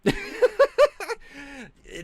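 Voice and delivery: shrill high-pitched laugh; loud gleeful cackle. Real, not acted. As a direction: cackle in glee. Cackle after insult.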